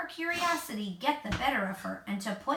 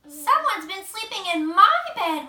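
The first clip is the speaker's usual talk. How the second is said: higher, much louder wail; wailing Mama Bear; 8 semitones higher, 10 dB louder than usual. A narrator's reading. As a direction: wail at the rumpled bed. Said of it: "Mama Bear wailed".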